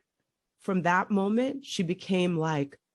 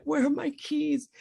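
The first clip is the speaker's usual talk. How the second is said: retold sobbing plea; 7 semitones higher than usual. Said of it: "I'm sobbing on the floor".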